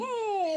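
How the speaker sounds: a brief high mock baby cry, hushed; faint newborn cry. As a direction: imitate a newborn's first weak cry, barely there. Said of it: "Bem baixinho, bem curtinho".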